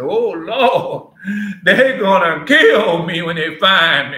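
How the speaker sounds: loud, laughing fake panic; mock terror. Cain crying out to the Lord in laughing mock fear. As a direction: ham up a frightened plea to the Lord. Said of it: "that fear and trembling came over and said".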